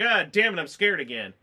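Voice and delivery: loud, high startled cry easing off; mock startled yelp. Viewer jolted by the jump scare, loud startled yelp. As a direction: jump like the scare got you.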